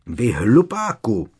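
loud, high, drawn-out insult; shouted outburst. A narrator's reading. Professor bursting out at the minister, shouting. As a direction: cry out in outrage, stretching the words. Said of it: "rozkřikla se".

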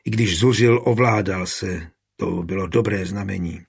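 usual speaking voice

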